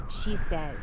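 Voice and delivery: ordinary delivery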